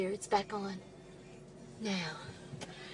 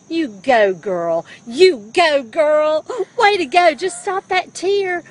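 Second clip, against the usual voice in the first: loud cheerful cheering; 2 semitones higher, 11 dB louder than usual. Real, not acted. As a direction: cheer her on, big and loud. Mom cheering up crying daughter.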